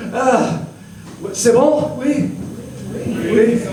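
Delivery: high French crowd check; French accent. Acted.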